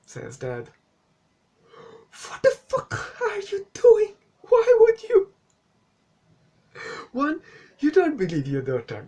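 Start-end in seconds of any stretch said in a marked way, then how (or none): 2.3-5.3 very high, loud squealing disbelief; exasperated outburst. Pretend. Reviewer scolding the dad, shrieking exasperation. Mock rant at the film's dad. 7.1-9.1 exasperated. Pretend. Counting off dad's mistakes, ranting.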